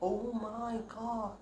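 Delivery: very slow, high and drawn out; stunned oh my God. Real, not acted. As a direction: stare at the damage in disbelief. Seeing too much hair cut.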